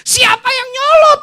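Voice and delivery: high, loud mock retort; angry wife retort. Wife retorting to husband, loud hammed-up anger. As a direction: snap back at him. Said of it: "Mamanya nyaut lagi".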